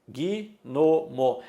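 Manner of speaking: "gnomo" split into gui, no, mo; syllabified "gnomo" with an added i after g; three syllables. Shown as a demonstration. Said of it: "Eu pronuncio em três sílabas".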